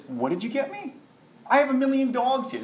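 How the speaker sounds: high-pitched incredulous royal scold; a king insulted by a cheap gift, pitched high; indignant king. Acted. King scolding the sage over dog's milk, high and indignant.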